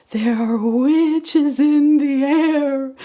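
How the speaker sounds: slow, high, spooky telling; hint of a spooky warning; spooky pumpkin. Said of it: "The second one said".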